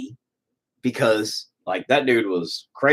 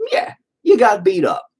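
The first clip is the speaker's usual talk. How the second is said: ordinary delivery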